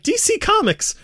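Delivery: high-pitched anxious fan; worried kid. Retold or imitated. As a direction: ask it like a nervous little kid. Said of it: "And you're like".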